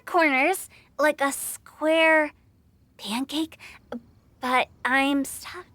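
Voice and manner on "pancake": breathy unvoiced reading; squinting whisper at scrawled writing; whispered aside. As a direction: puzzle it out under your breath. Host reading messy handwriting.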